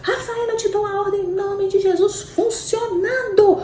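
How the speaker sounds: high, intense prayer of command. Retold or imitated. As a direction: order it in Jesus' name. Praying command over daughter. (oração de comando intensa)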